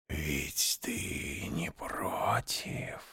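slow, soft serpent whisper; whispered question. A narrator's reading. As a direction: ask it right at his ear. Snake asks, face close.

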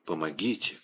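очень тихо и низко, угасая; еле слышная мольба умирающей; слабый шёпот. A narrator's reading. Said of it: "прошептала госпожа Бонасье". (почти беззвучно, из последних сил)

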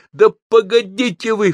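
high, insistent outburst; child suddenly objecting; rousing protest. A narrator's reading. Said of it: "встряхнулся я".

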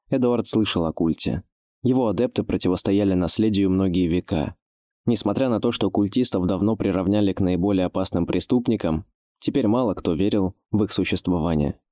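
usual speaking voice

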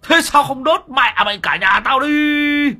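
screaming curses, very high and loud; cursing rage. A narrator's reading. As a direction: chase and curse them at full volume. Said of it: "mà đuổi, mà chửi". (vừa đuổi vừa chửi, gào thét)

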